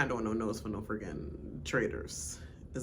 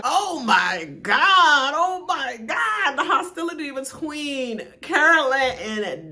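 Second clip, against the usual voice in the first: loud, higher and slow with delight; excited gushing; 8 semitones higher, 8 dB louder, slower than usual. Real, not acted. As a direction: savor the drama out loud. Gushing about the cast's hostility.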